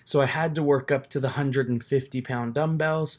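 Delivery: voiceover narration. Real, not acted. Vlogger narrates his chest workout to viewers in a soft voiceover. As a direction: talk over the footage, calm and close to the mic. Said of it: "tips on a voiceover".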